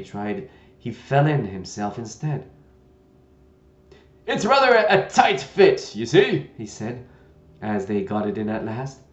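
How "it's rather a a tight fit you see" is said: put-on loud, gentle old knight; a kindly, bumbling old knight. Knight voiced in story.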